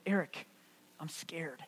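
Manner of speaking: frightened, hushed and shaking; a scared young man's trembling whisper. Retold or imitated. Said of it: "with a trembling voice".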